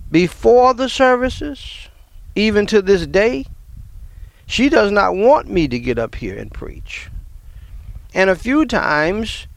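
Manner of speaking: impassioned preacher warming up; preaching. Acted. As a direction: build it up from the pulpit. Preaching at full force.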